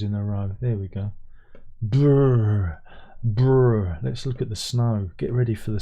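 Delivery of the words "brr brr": loud playful shiver; shivering brr. Pretend. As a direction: lips buzz brr like freezing. Shivering at snow pictures.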